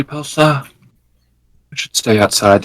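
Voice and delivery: usual speaking voice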